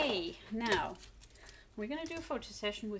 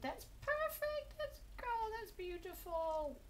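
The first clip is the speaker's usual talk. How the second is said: cooing praise; 12 semitones higher, slower than usual. Real, not acted. Cooing at posing cat.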